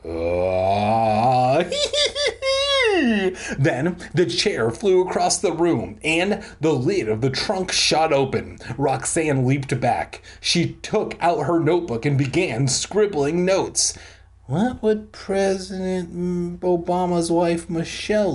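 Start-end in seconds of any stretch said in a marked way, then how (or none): 0.1-3.5 eerie moan into shrill laugh. Acted. Ghost filling the room, eerie moan then shrill laugh. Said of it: "eerie moan filled the room, followed by a shrill laugh". 14.5-18.3 slightly raised, monotone put-on voice; mock note-taker. Roxanne writing her ghost notes, mock-earnest.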